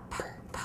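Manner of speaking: breathy whispered p sound. Shown as a demonstration. Teacher whispers letter sounds to young students. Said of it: "T, a".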